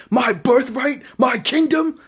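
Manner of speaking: high, incredulous mock indignation; hammed-up betrayed prince demanding answers; acted outrage. Acting Vegeta confronting Beerus.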